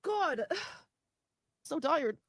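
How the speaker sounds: high, teary, exhausted voice; crying, worn out. Real, not acted. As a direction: speak through tears, drained. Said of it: "I'm so tired of crying".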